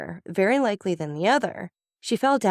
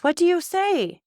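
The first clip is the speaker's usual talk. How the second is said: high, shocked cry of disbelief; frightened exclamation; 12 semitones higher, 4 dB louder than usual. A narrator's reading. Wife crying out at her husband's accusation, high and frightened. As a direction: gasp it out in sudden terror. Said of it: "exclaimed Madame de Villefort".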